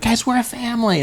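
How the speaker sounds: high, chirpy boss voice; sing-song corporate cheer; mock cheesy boss. Mocking a boss.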